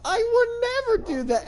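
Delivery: very high squeaky protest; mock outraged denial. Accused prisoner denying he ate an infant, mock outraged. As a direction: squeal it in comic disbelief.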